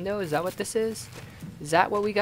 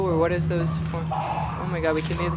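normal talking voice

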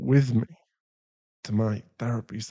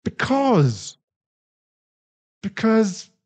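choked up; 10 semitones higher, 6 dB louder, much slower than usual. Acted. Remorseful partner breaking down.

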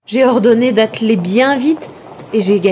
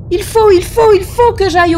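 frantic compulsion; 11 semitones higher, 3 dB louder than usual. Acted. Seized by a sudden compulsion.

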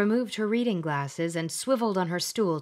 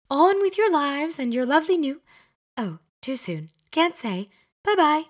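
much higher and louder, giddy witch; gushing Glenda; 10 semitones higher, 6 dB louder than usual. Acted. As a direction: shoo them out cheerfully. Glenda shoos them out.

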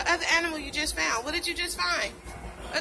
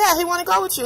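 usual speaking voice

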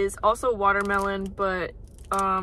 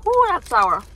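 sour surprise; 5 semitones higher, 6 dB louder than usual. Real, not acted. Reacts to sour candy.

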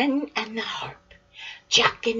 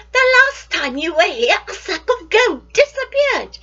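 higher, louder scolding voice; scolding giant's wife; 10 semitones higher, 6 dB louder than usual. Acted. Wife reminding Jack of the stolen gold, high and scolding. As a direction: tell him off sharply.

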